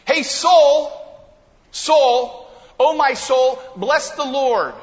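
loud, high, rousing call to his own soul; fired-up call to his soul. Retold or imitated. David stirring up his own soul to worship, loud and high. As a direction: rouse yourself to worship, full voice. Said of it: "he's trying to fire up his worship".

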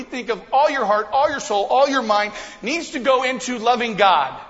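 loud, high, passionate build; fired-up preaching. Acted. Pastor rallying the church, loud and passionate. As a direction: preach with rising fire.

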